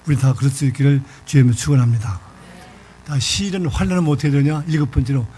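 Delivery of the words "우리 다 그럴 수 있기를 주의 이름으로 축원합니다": blessing. Real, not acted. Pastor's blessing.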